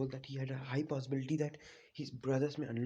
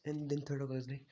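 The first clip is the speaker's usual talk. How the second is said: normal talking voice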